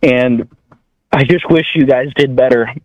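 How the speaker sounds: ordinary talk, nothing marked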